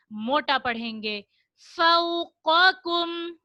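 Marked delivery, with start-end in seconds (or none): 1.8-3.4 slow melodic tilawat with drawn-out vowels; Quran recitation. Shown as a demonstration. Teacher reciting the verse to the children, slow melodic chant. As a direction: chant the verse slowly like a qari.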